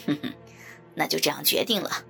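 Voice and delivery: low, satisfied chuckle, then verdict; deep, self-satisfied villain voice; smug villain. A narrator's reading. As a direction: settle it gloatingly in a deep voice. Villain gloats over forced agreement.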